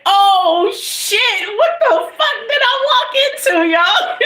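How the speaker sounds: high laughing "what the fuck" in disbelief; shocked laughing outcry. Retold or imitated. Storyteller reliving walking into the house, shocked and laughing. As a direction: squeal it out, half laughing. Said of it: "and I'm like".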